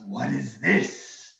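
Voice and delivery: slow, loud, suspicious dragon. Acted. Dragon examining the gold, dragon voice. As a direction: draw it out, questioning, as the dragon. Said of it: "he looks at the gold and he goes".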